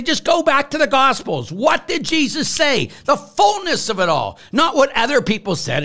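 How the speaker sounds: high, insistent rebuke; heated preaching. Real, not acted. Priest sending critics back to the Gospels, heated and insistent. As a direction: drive the point home with rising heat. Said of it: "I get a little hot".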